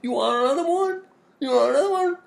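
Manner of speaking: very high, loud mock bartender; pushy bartender. Bartenders pushing drinks for money, hammed-up high nagging. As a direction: badger them in a squealing, exaggerated falsetto. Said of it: "so they were like".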